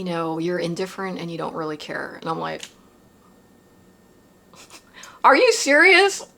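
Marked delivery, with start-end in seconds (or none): 5.2-6.3 incredulous. Retold or imitated. Reacting in disbelief to the guidance.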